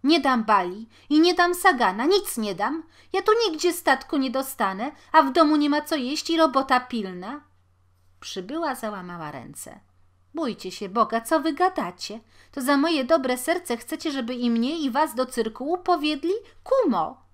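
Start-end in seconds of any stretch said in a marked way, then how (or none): 0.0-7.4 much louder, higher desperate shout; wild refusal. A narrator's reading. Mother to the neighbour, a loud desperate refusal. 10.3-17.2 much louder, higher pleading; frantic plea. A narrator's reading. Neighbour to the washerwoman, loud and frantic.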